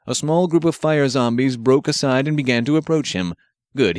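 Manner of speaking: ordinary delivery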